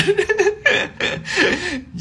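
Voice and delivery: sudden high laugh breaking out; burst of high laughter. Real, not acted. Laughing at own childhood revenge, high burst of laughter. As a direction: crack up at the memory.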